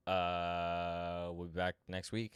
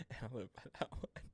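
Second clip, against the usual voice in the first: laughing; 2 semitones higher, 7 dB quieter than usual. Real, not acted. Laughing repeat.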